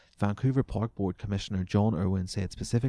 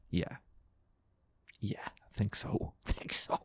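laughing whisper; 6 dB quieter than usual. Real, not acted.

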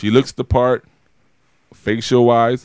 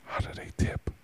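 mock whisper; 15 dB quieter than usual. Joking million dollar question.

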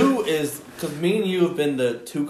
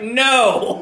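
mock flat refusal; 8 semitones higher, 7 dB louder than usual. Fan refusing.

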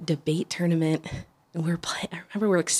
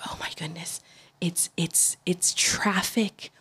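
retold panic, whispered and slow; scared whisper; 6 dB quieter, slower than usual. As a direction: whisper it as if the car might fall apart. Panicking in the shaking car.

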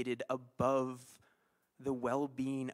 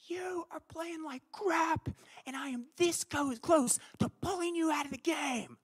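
retold coach yell; 13 semitones higher than usual. Youth soccer coach berates a new defender, a mock high yell. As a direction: lightly act out a furious coach telling a kid off. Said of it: "he yells out".

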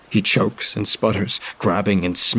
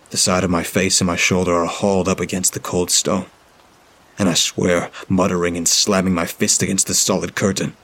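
usual speaking voice

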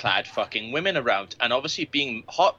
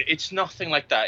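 normal talking voice

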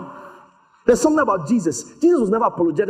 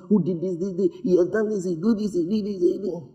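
rattled-off complaint; 4 dB quieter than usual. Retold or imitated. Mimics woman's rapid list of accusations.

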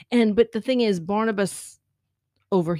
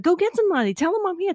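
urgent plea; 9 semitones higher, 4 dB louder, faster than usual. Retold or imitated. Maggie pleads with ghost girl.

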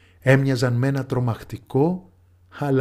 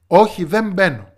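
higher, firm loud refusal; stubborn daughter; 6 semitones higher, 5 dB louder than usual. A narrator's reading. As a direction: refuse firmly once more. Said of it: "έλεγε η κόρη". (πεισματάρα κόρη, ανένδοτη)

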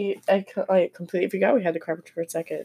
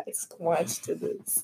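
laughing quietly; 12 dB quieter, much slower than usual. Real, not acted. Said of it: "Oh my God".

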